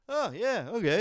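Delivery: high, clueless mock yes; dumb agreeable friend. Friend imitating a clueless drunk agreeing to follow a stranger. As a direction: play the dopey guy who agrees to anything. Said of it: "picturing you just being like".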